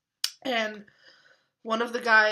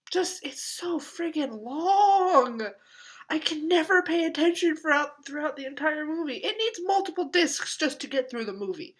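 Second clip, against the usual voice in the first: exasperated rant; 7 semitones higher, 5 dB louder than usual. Real, not acted. Exasperated rant about film length.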